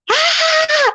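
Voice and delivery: high-pitched imitated yell, "ah"; mock yelling. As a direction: mimic someone yelling at the top of their voice, without real volume. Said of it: "you're yelling like a fool".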